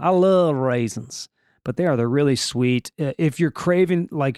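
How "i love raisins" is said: slow Southern drawl; Southern accent. Shown as a demonstration. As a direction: lean into a Southern drawl, stretching the words. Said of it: "I don't know why I got Southern".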